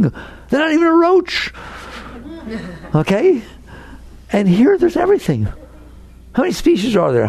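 ordinary delivery

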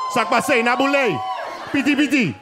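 imitating a Haitian dad in Haitian Creole; hammed-up Haitian accent. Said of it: "My dad's Haitian".

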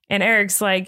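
ordinary delivery

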